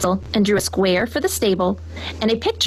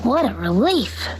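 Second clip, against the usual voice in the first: mild gasp of relief; 2 semitones higher than usual. A narrator's reading. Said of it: "gasped Henry".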